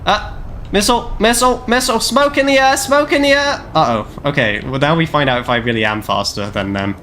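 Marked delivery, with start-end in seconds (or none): 0.1-3.6 real alarm at an incoming missile; alarmed shouting. Real, not acted. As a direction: yell the warning as the missile comes. Missile warning during the game.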